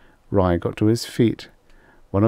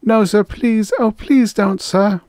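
high, loud, desperate begging; 6 dB louder than usual. Acted. Rye begs Extroni to spare him, high and desperate. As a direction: plead for mercy. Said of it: "Extroni ordered".

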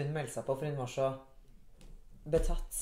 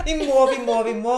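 higher, louder laughing eager voice; mock eager; 7 semitones higher, 11 dB louder than usual. Friend mimics eager selfie pleading, higher and louder. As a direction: pump it up like an overexcited fan. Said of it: "vi må ta en selfie".

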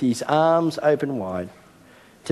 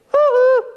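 very high, loud teasing woo-hoo; playful child hoot; 7 dB louder than usual. Retold or imitated. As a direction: hoot like a hiding child giving herself away. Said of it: "next minute you hear this".

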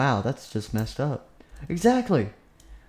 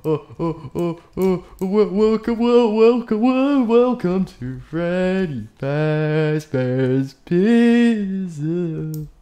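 stammering, very high and loud, drawn out; hammed-up stage-fright stutter; nervous stammer; 5 semitones higher, 7 dB louder, much slower than usual. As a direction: force the welcome out through stage fright. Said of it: "You say what you're supposed to say".

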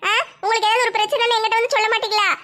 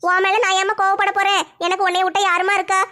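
ordinary talk

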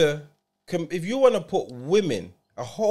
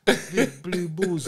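drawn out slowly, amused; slow teasing drawl; much slower than usual. Real, not acted. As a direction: stretch each word lazily.

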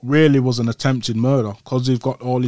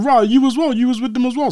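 much higher, louder, worked up; heated inner voice; 12 semitones higher, 4 dB louder than usual. Retold or imitated. Thinking at the men in the other car, high and heated. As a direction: fire it at them, keyed up high.

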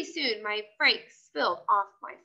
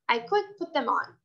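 usual speaking voice

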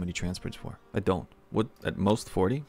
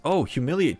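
usual speaking voice

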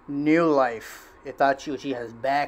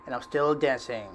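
normal talking voice

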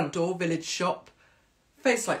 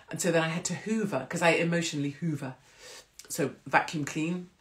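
usual speaking voice

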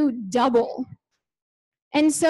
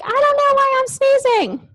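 puzzled sneezer; 12 semitones higher, 4 dB louder than usual. Retold or imitated.

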